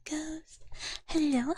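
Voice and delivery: usual speaking voice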